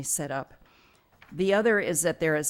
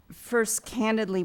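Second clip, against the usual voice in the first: ordinary talk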